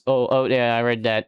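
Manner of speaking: ordinary talk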